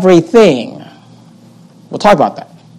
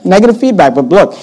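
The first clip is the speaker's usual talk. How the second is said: normal talking voice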